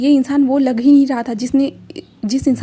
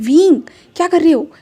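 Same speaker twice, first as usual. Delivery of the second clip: a startled, louder, higher question; jolted by the scream, demand what he's doing; 5 semitones higher, 7 dB louder than usual. A narrator's reading. Wangji startled by Wing's scream, louder and higher.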